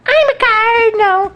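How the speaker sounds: a voice that suddenly turns shrill and strange; high odd voice. Acted. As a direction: announce it in a strange squeaky voice. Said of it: "Your voice keeps changing".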